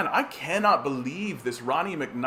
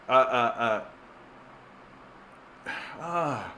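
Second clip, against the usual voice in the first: ordinary delivery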